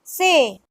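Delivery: "se" said as "seh"; Spanish letter C. Shown as a demonstration. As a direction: say se with a pure Spanish e. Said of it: "C is pronounced as".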